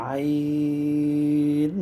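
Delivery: আইন drawn out very slowly on one note; held four alif long. Shown as a demonstration. Teacher reciting the letter for viewers, very long. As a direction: hold আইন long like a chant. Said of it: "চার আলিফ".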